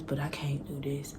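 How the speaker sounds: quiet whispered give-up; whispered strain. Retold or imitated. Recalling the pain, she whispers she can't take it. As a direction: whisper it like it's too much. Retelling the pain of first time.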